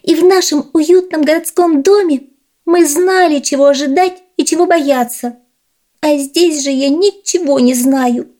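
high squeaky little mouse; tiny high mouse voice. Acted. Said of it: "подтвердила городская мышь".

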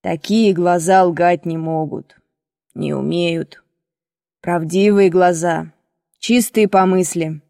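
put-on low, slow, tender old man; affectionate. A narrator's reading. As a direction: speak gently and unhurriedly, with fondness. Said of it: "добавил ласково".

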